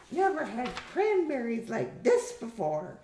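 high-pitched disdainful dinner guest; high, critical woman's voice; scornful Gladys. Acted. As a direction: sniff at the food in a high character voice.

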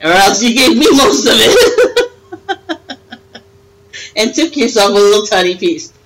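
laughing through the words, high; giggling accusation. Real, not acted. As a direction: tease her while cracking up. Laughing while teasing Deborah.

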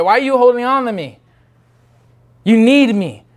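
normal talking voice

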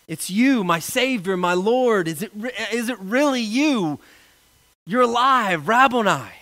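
a stirred, rising voice in disbelief; joyful recognition. Acted. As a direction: pour out the joy of seeing him alive. Pastor voices Mary's joyful recognition.

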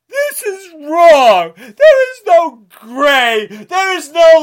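sobbing and shouting with outrage; crying shout. Real, not acted. Ranting at Republicans, crying and shouting. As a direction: yell through real tears. Sobbing rant all through.

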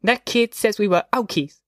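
put-on high-pitched indignant child; small boy protesting an insult, pitched high; voiced boy, mildly outraged; outraged young boy. A narrator's reading. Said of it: "in an outraged voice".

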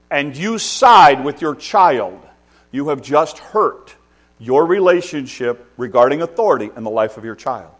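loud, high, driving sermon; preacher warning parents, loud and high; full-force preaching. Acted. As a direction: pound the point home from the pulpit.

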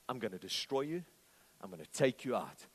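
low, soft threat as Goliath; quiet intimidation. Acted. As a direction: menace them quietly, voice dropped right down. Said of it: "is the voice of intimidation".

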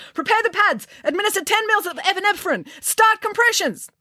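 put-on high, urgent young doctor shouting orders; a frantic doctor barking orders. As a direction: bark commands at the nurses. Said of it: "barking orders".

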